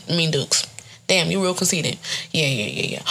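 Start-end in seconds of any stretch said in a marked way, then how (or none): none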